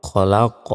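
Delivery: "খলা ক" with a pushed, echoing final q; qalqalah bounce. Shown as a demonstration. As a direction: bounce the last consonant of "খলা ক". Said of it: "শেষে ধাক্কা দিয়ে পড়তে হবে".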